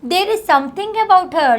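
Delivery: lightly voiced thoughtful man, slow. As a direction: say it slowly, musing over the girl. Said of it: "Slowly, pollen जी धीरे से बोलता है".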